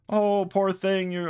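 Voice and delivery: slow, high pitying coo; ridiculously sweet. Retold or imitated. Sister pitying the manticore in a slow high coo. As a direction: drip with pity, slowly and high. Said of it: "it's just so ridiculously".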